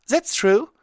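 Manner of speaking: plain reading voice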